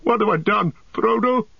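very high, desperate calling; anguished calling. A narrator's reading. Boromir calls after vanished Frodo, desperate cry. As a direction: call out in remorse, pitch far up. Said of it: "he called".

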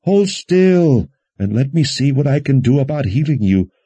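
high, urgent, distressed order; tearful admonishing. A narrator's reading. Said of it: "admonished through her own tears".

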